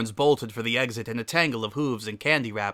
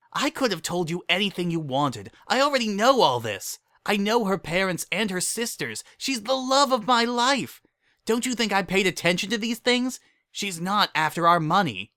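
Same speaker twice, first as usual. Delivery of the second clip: indignant defense; 9 semitones higher than usual. Acted. Lyra defending her fiancée.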